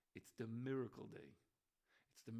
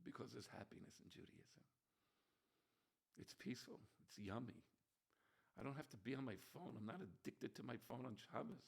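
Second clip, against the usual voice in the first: much softer, half-whispered; hushed sincerity; 7 dB quieter than usual. Acted. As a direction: draw them in with a hushed voice. Soft contrast after shouting.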